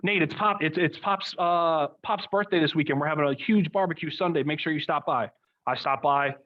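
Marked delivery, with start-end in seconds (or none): 0.0-5.3 neighbor inviting. Retold or imitated. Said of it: "they'd be like".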